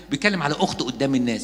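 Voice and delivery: usual speaking voice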